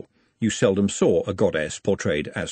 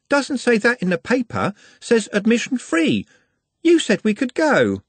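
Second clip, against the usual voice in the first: put-on high, eager young woman, louder; bright girl pushing her point, higher and louder; 12 semitones higher, 3 dB louder than usual. Juliet voiced, insisting.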